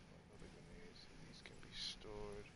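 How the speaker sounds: whispering. Real, not acted. Reading game text, voice drops.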